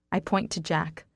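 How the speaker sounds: usual speaking voice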